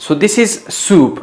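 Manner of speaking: "soup" said slowly, a long rounded oo. Shown as a demonstration. Teacher modelling "soup" for students, slow and clear. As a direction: stretch "soup" as a long soup vowel. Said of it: "अक्सर हम गलत बोलते हैं".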